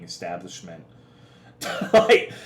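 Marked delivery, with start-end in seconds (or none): 1.6-2.3 laugh. Real, not acted. Laughing at chat comment.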